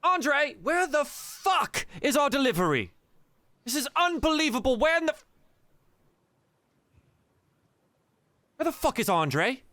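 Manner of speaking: high, loud, furious barking at the bar; furious woman. Acted. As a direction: storm in demanding where Andre is.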